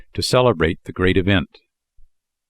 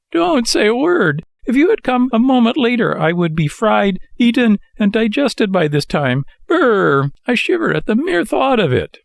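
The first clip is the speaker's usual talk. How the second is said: shudder through it, still terrified; trembling with fright; 13 semitones higher than usual. A narrator's reading. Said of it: "still trembling with fright".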